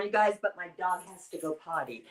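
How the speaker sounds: walking around while talking, off-mic; pacing near and far. Real, not acted. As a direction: voice drifting away from the mic. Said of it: "I'm walking around".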